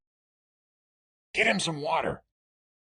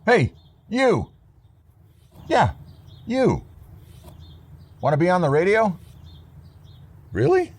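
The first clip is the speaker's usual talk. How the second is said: calling out; 9 semitones higher than usual. Acted. Calling out to a passerby.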